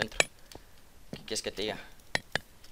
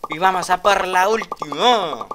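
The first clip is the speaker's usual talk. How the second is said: loud, high, slow hype call; hype announcement; 8 semitones higher, 14 dB louder, slower than usual. Real, not acted. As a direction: announce the final round big. Announcing the last match. (anuncio épico, lento y fuerte)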